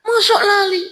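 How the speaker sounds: very high falsetto woman; high put-on female voice; woman's voice. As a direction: pitch way up as the woman answering. Voicing the veiled woman.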